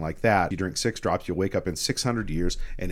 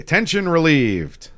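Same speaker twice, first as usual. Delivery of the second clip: mock proclamation; 9 semitones higher, 6 dB louder, slower than usual. Comic announcement of sudden peace.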